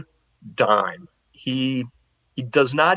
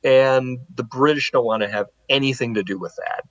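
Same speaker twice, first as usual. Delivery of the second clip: usual speaking voice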